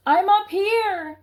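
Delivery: high, loud and slow call down to grandmother; shouted from the treetop. Acted. Boy shouting down from the tree to his grandmother, high loud slow call. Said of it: "I shouted back".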